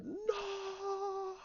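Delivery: a quiet moan of despair; horrified cry. Retold or imitated. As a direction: Luke seeing the truth, high and anguished. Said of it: "you hear the".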